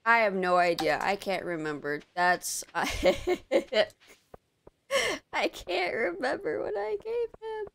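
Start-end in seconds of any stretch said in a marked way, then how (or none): 5.3-7.7 much higher, silly squeaky voice; silly squeak. Real, not acted. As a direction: squeak it like a cartoon. Forgetful squeak.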